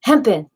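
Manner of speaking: "Hempin'" with a dropped g, falling; hempin' said playfully. Shown as a demonstration. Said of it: "but it's hempen".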